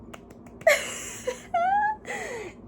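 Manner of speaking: high, squeaky burst of laughter; real giggle, high and breathless; high-pitched laugh. Real, not acted. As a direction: laugh up high, almost crying. Laughing at the character's lie.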